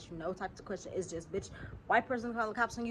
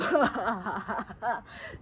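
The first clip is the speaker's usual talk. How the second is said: amused laugh; 6 dB louder than usual. Real, not acted. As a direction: open laugh at her own joke. Laughing at her own line.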